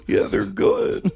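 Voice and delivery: put-on very high, slow kid-like voice; high put-on voice of a force-fed son; reluctant kid character. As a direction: squeak out a reluctant answer, drawn out. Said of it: "his character was that he had to".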